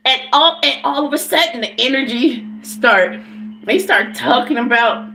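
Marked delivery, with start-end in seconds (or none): none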